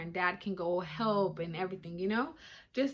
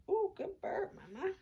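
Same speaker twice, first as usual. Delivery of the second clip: cooing praise; 10 semitones higher, 5 dB quieter than usual. Real, not acted. Praising the baby's burp.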